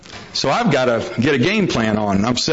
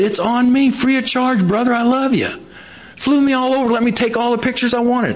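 excited retelling; 8 semitones higher than usual. Retold or imitated. Retelling John's excited generous friend.

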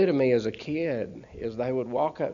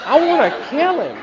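even higher laughing shout; furious, higher; 13 semitones higher, 9 dB louder than usual. Retold or imitated. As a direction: push the pitch up further, half laughing. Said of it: "I'm so mad".

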